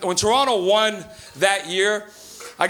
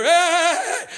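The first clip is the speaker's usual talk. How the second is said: calling out; 9 semitones higher than usual. Retold or imitated. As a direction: catch him before he hangs up. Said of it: "I hear".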